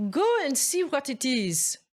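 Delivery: mother sending daughter to see; mother's voice. A narrator's reading. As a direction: coax your daughter outside. Mother speaking to Mirette.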